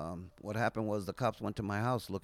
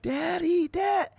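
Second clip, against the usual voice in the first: much higher, imitating a toddler calling Daddy; little daughter calling; 8 dB louder, much slower than usual. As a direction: mimic a small girl crying out for her dad. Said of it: "she'd be like".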